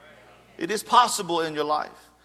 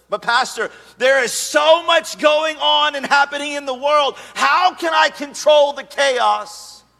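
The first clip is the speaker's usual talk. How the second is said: higher, louder, slowed overwhelmed cry; pleading congregant; 6 semitones higher, 5 dB louder than usual. Acted. Pastor voicing a worried churchgoer, loud anxious plea. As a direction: throw up your hands like a swamped believer.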